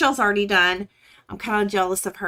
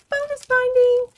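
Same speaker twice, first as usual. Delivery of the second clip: delighted squeak; 14 semitones higher than usual. Real, not acted. Delighted at a bonus.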